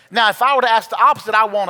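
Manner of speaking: fast, loud emphatic preaching; loud soapbox ranting. Real, not acted. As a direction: press the point loudly and quickly. Preacher challenges congregation.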